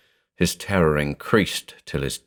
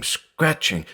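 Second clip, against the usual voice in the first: scratching said higher and tighter, fear rising; frightened, pitched higher; 8 semitones higher than usual. A narrator's reading. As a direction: echo the word scratching in a higher, more alarmed voice. Listens in terror.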